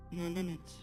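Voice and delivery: ordinary talk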